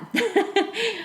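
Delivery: a quick high laugh that drops away; a short amused laugh; high bright laugh. Real, not acted. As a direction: laugh it off lightly. Laughing at her own joke.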